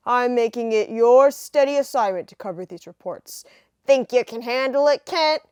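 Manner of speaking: a 1930s newspaper chief. Acted. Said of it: "nineteen thirties voices".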